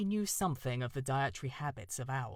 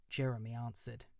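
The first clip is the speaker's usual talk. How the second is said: plain reading voice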